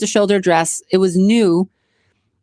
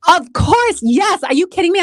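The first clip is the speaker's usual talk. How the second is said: thrilled yes; 9 semitones higher than usual. Retold or imitated. Photographer asks for a picture, much higher eager gushing. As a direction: squeal a delighted yes to the photographer. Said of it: "I'm like".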